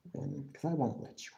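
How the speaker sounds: breathy whispered repeat of the taunt. Shown as a demonstration. Taunting a chat viewer, repeating it in a whisper. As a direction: whisper it like a secret threat.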